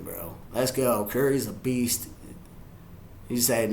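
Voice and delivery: ordinary delivery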